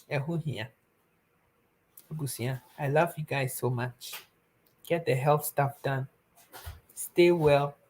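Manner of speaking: usual speaking voice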